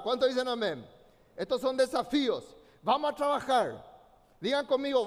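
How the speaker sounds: rally the congregation to the task; rousing preaching. Acted. Preaching call to action.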